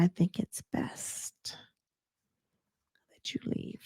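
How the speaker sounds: soft whisper, no voice; hushed polite brush-off; whispered dismissal. Retold or imitated. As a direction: quietly show her the door. Sweet Tea tells Quad to leave.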